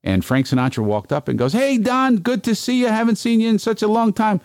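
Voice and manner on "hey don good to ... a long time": very high, hammed-up hello; showy greeting. [name] walks up to the comedian's table, showy hearty greeting. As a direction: greet a supposed old pal for all to hear, pitched way up.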